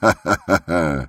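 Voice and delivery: acted guffaw; rolling ha-ha-ha of bravado; hearty laugh. A narrator's reading. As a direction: burst out in a jolly chuckle. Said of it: "захохотал Михаил Аверьяныч".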